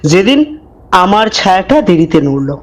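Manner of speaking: low, hushed storyteller voice; eerie narration, measured and dark; horror story narration. A narrator's reading. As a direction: draw the listener into a creepy tale. Horror story narration before intro.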